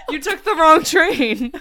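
loud, slow, laughing through the line; laughing quote. Retold or imitated. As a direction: quote it while cracking up. Quoting the drama, laughing.

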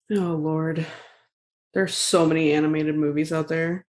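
ordinary talk, nothing marked

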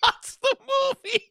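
laughing through the words; cracking up while repeating it. Real, not acted. Repeating friend's joke, cracking up.

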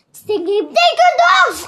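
high, loud command; crying out. A narrator's reading. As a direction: yell the order urgently.

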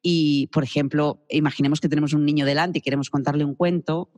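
ordinary talk, nothing marked

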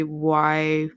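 usual speaking voice

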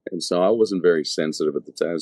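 ordinary talk

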